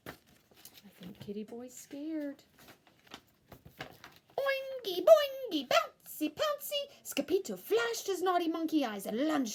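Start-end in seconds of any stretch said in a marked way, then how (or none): 0.1-2.4 soft aside. Acted. 4.4-6.9 bouncy sing-song. Acted. Bouncy rhyme.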